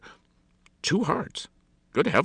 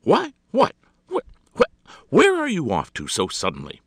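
high, loud sputtering surprise; stammer, taken aback by news; startled; 13 semitones higher, 10 dB louder than usual. Acted. Hostess startled by his good-bye.

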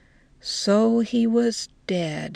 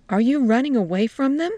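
quick, high question rising at the end; taken aback, pitch lifted; surprised; 6 semitones higher, 4 dB louder, faster than usual. A narrator's reading. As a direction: ask it in sudden surprise.

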